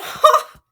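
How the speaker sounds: sudden high, loud laugh; a helpless laugh bursting out; burst of laughter. Real, not acted. Laughs mid-reaction.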